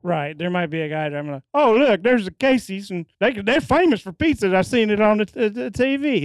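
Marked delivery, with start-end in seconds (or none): 1.5-6.2 higher, lightly voiced excited dad; a light impression of his own dad gushing over Casey's; hint of an eager small-town dad, higher pitched; imitating his dad. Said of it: "you just became your dad there".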